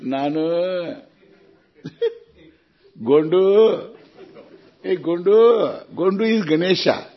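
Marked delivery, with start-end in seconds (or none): 0.0-1.0 calling a nickname. Acted. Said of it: "which Narayana is called Narayana?". 3.0-5.9 higher, drawn-out shout of a name; calling out. Acted. Mimics families calling a child by a nickname, raised calling voice.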